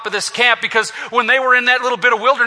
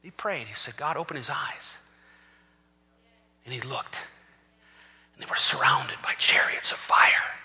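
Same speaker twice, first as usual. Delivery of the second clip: very soft, low suspenseful narration; hushed storytelling; 11 semitones lower, 9 dB quieter than usual. A narrator's reading. Preacher tells the congregation of chariots of fire, hushed. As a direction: drop right down and let the wonder land.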